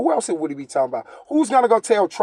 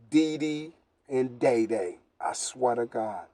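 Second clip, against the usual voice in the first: hushed reveal; 6 semitones lower, 11 dB quieter, slower than usual. Real, not acted. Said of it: "secret names revealed".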